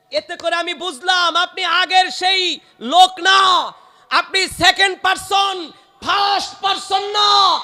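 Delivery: ringing sermon delivery, pitched high; impassioned preaching. Acted. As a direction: voice the accusation like a preacher to a crowd. Preacher acts out quote at full force.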